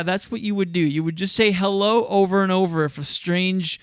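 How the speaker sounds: usual speaking voice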